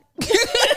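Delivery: loud high burst of laughter. Real, not acted. Friends joking about the kids wanting snacks, a loud high laugh. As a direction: crack up at the joke.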